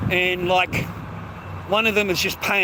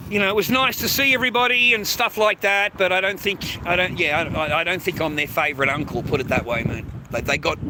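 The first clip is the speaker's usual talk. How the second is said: ordinary talk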